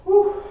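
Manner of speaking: a loud puffing exhale; breathless whew. Real, not acted. Instructor catching breath in front of the class, puffing whew. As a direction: blow out air, winded from the workout.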